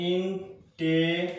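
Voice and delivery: "ইনটে-" very slowly, in separate syllables. Shown as a demonstration. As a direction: break "ইনটে-" into drawn-out beats. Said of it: "আমি কয়বার উচ্চারণ করলাম".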